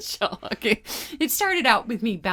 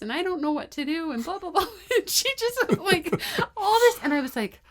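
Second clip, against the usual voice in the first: laughing; 9 semitones higher, 3 dB louder than usual. Real, not acted. Laughing retelling daughter.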